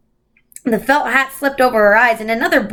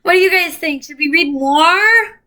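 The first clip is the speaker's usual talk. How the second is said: Dora-like; 5 semitones higher than usual. Pretend. Singsong like Dora.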